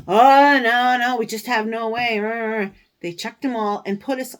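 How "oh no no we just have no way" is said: loud, dismissive brush-off; mocking customs officer. Mimicking customs refusing to check, loud and dismissive. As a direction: wave her off mockingly.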